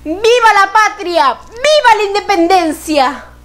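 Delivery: high, ringing declaration to a crowd; proclamation. Acted. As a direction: proclaim it like a public decree. Proclaiming independence in the poem.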